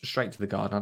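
ordinary delivery

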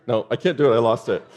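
normal talking voice

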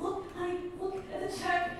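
high, soft, urgent plea; small high voice trying to get attention; hushed freak-out. Retold or imitated. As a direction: imitate freaking out under your breath. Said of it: "kind of freaking out".

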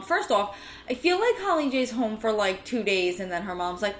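ordinary delivery

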